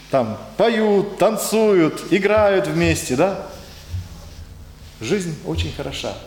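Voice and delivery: ordinary talk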